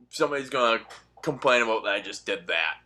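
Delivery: usual speaking voice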